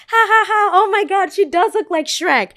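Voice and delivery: very high, loud mocking cackle; mocking laugh. Friends siding with the boys, very high loud mocking laughter. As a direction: laugh at her to please the boys.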